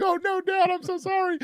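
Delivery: put-on very high, squeaky little-kid voice; high-voiced son. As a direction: put on a mock child's falsetto apology. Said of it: "why his voice is still so high".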